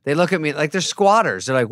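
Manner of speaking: ordinary talk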